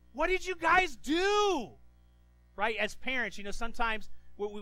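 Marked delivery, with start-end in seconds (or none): 0.1-1.7 high, loud, slow angry parent yell; exasperated father roaring at his sons; angry shout. Retold or imitated. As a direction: yell at the kids for the mess. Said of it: "He's like".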